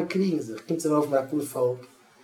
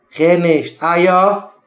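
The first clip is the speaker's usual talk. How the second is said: loud, high challenge; heated argument; 5 semitones higher, 12 dB louder, much slower than usual. Acted. Parents quarrelling over the child's teacher, loud challenge. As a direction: throw it back louder and higher.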